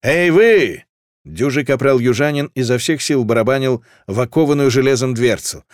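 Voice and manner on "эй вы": loud, raised calling out; high, forceful soldier's hail; corporal's yell. A narrator's reading. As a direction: holler at a shut door. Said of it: "орал капрал".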